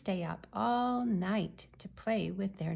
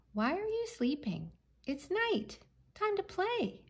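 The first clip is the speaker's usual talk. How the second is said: high voice, rising ever higher; puzzled young owls; 12 semitones higher than usual. A narrator's reading. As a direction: urge sleepy friends in a high voice. Puzzled owls urge sleepers to play.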